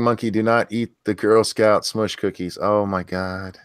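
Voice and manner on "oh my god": normal talking voice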